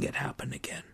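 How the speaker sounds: hushed, unvoiced grieving whisper. Real, not acted. Priest lamenting to listeners, grieving whisper. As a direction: breathe it out as a sad confession. Grief after applause for vows.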